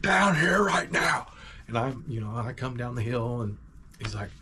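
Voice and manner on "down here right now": slow, high, loud mock yell; drawn-out hollered order; imitating livid dad. Dad ordering son down the hill, mock furious yell.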